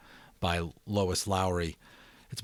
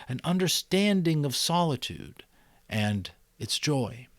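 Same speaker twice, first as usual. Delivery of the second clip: expressive read-aloud narration; story narration; 5 semitones higher, 6 dB louder than usual. A narrator's reading. Narrator reading The Giver to subscribers, expressive storytelling.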